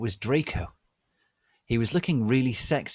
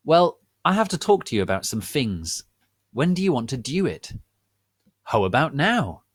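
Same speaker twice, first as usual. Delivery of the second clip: teacher voice; 5 semitones higher, 5 dB louder than usual. Acted.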